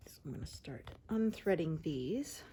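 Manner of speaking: soft whispered craft narration; ASMR whisper. Acted. Explaining the next craft step to viewers, whispered. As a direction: whisper each step as you work.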